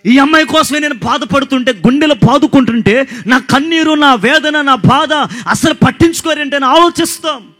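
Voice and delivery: loud, high, fervent preaching to the crowd; full-force preaching. Acted. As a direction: preach it out, building and calling hallelujah. (బిగ్గరగా, ఉత్సాహంగా ప్రసంగం)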